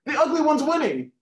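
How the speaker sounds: high, loud mock panic; urgent caller. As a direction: wail the punchline as the distressed caller. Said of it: "The man".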